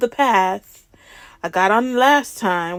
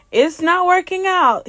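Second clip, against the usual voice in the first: high-pitched complaint; retold protest; 8 semitones higher, 3 dB louder than usual. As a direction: protest back to the instructor, pitched up.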